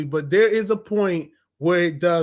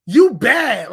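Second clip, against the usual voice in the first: higher, louder cocky jab; bratty kid taunt; 4 semitones higher, 8 dB louder, much slower than usual. Retold or imitated. Pinocchio mouthing off, cocky and loud. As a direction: toss it out like a cheeky brat. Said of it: "He's just like".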